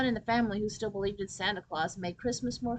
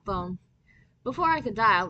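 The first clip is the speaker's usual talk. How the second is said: usual speaking voice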